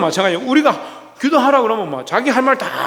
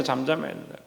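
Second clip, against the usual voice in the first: 잠잠해야 dropped to a near hush, rising softly; hushed repeat; 6 semitones lower, 12 dB quieter than usual. Shown as a demonstration. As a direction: say 잠잠해야 된다 again much softer and lower.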